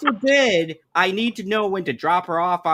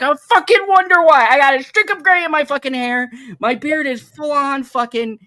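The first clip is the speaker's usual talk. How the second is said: louder, higher fed-up rant; exasperated outburst; 8 semitones higher, 6 dB louder than usual. Real, not acted. Husband venting about his partner's gray-hair remark, loud and fed up. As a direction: vent it loud and high.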